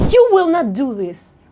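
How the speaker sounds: loud, firm command from the first word; high volume. Shown as a demonstration. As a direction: say it loud and forceful. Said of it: "let's change the volume".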